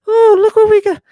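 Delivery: squeaky high mock wonder; hammed-up gasp of delight at a discovery; over-the-top falsetto surprise; mock amazement. Mock delight at plural dogs.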